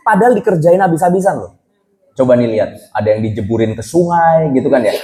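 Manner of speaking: ordinary delivery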